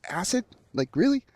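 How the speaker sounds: retelling his own stunned disbelief, voice shooting up; incredulous. Retold or imitated. Disbelief at being offered acid.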